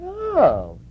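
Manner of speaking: loud, very high Brooklyn "oh"; Lena. Retold or imitated. Lena hears who the visitor is, lispy Brooklyn voice. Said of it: "in this very thick Brooklyn lispy voice".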